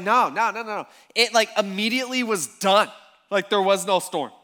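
loud, emphatic correction; emphatic insistence. Retold or imitated. Disciples correcting Luke about the storm, insistent.

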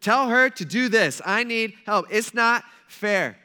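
loud, high mock outburst; bossy, worked-up demand. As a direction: order him about with indignant emphasis. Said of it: "She's bossing Jesus around".

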